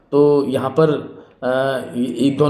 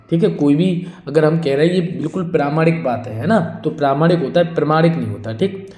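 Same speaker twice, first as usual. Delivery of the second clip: ordinary talk, nothing marked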